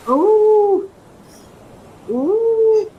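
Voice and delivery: delighted ooh. Real, not acted. Unboxing, delighted.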